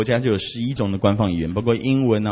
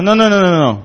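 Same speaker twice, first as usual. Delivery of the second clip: mock correcting; 7 semitones higher, 6 dB louder than usual. Vianney correcting the name.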